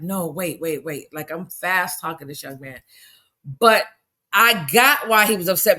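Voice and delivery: usual speaking voice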